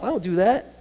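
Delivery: ordinary talk, nothing marked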